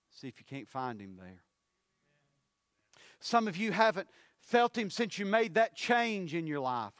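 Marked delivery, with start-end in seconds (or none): 3.2-6.9 loud, higher-pitched preacher's call; preaching at full force. Acted. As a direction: press the point home to the church. Preacher raising voice in sermon.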